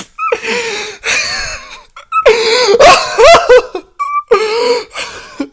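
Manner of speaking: loud high laughing fit; cracking up completely; helpless laughter. Real, not acted.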